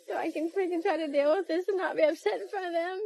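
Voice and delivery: holding back tears while explaining; real tears through the words; crying. Real, not acted. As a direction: voice breaking with tears. Evicted mother breaking down.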